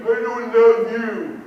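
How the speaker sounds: mock child's blunt taunt; kid voice. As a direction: act out a kid saying it to provoke.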